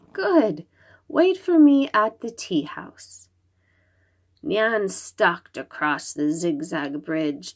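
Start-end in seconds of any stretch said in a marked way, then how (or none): 0.1-3.3 put-on little girl voice. Little girl Xingling answers.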